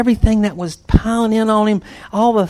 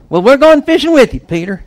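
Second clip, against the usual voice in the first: quick, much higher and louder eager shout; eager disciples; 8 semitones higher, 9 dB louder than usual. Acted. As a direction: jump in excitedly, we're coming too. Disciples eagerly join Peter.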